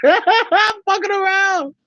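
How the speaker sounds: high-pitched, drawn out through laughter; laughing. Real, not acted. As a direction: laugh through it, owning up to the joke. Joking, cracks up at own teasing.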